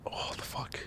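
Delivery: hushed, whispered bewilderment; whispered aside. Real, not acted. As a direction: whisper it under the breath. Muttering to himself after long pause.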